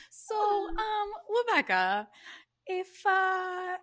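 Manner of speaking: high squeaky cat voice. Pretend. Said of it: "the cat voice".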